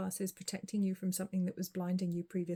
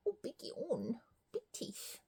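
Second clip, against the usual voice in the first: hushed unvoiced whisper; 7 semitones higher, 6 dB quieter, slower than usual. Real, not acted. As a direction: whisper so the cat stays calm. Cat yawns, she whispers.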